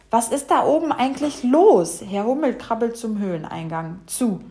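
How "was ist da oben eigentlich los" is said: louder, higher cross question; fed-up storybook outburst; exasperated demand. Acted. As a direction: demand crossly, rising in irritation. Bumblebee fed up with noise.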